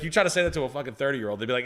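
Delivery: ordinary talk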